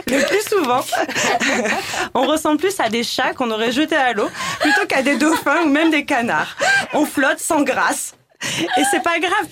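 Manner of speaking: giggling through the words; laughing. Real, not acted. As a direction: laugh while you talk. Laughing through her own punchline.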